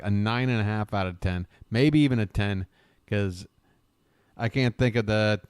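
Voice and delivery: normal talking voice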